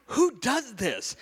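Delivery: high, drawn-out indignant protest; acted-out incredulous complaint, dragged out high; exasperated disbelief. Grandfather reacting to the hospital policy, exasperated. Outraged reply to nurse's policy.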